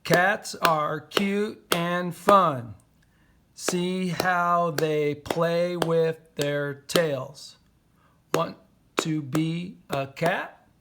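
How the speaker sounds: whole haiku read slowly, syllable by syllable; measured, drawn-out recitation. Shown as a demonstration. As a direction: read it out like counting beats. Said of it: "our haiku is five seven five".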